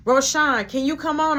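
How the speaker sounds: retold yell, moderately loud; yelling at coworker. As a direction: call them over impatiently. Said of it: "She le-, yelling at Roshan".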